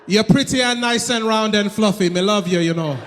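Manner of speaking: backhanded compliment in mock Jamaican accent. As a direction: flatter her, imitating a Jamaican accent. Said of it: "make the insult seem like a compliment".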